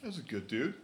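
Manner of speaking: lightly voiced laid-back wrestler; big sexy voice. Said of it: "do the big sexy voice".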